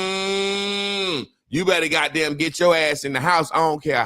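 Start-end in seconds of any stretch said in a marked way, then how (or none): 0.0-1.3 lightly voiced cow lowing hum; cow moo imitation. Describing farm animals sensing storms, a light mock moo. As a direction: hum a cow's warning. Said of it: "when that goddamn cow go".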